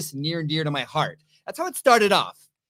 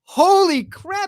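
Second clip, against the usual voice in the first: stunned exclamation; 6 dB louder, much slower than usual. Real, not acted. Hearing a whole day became four seconds.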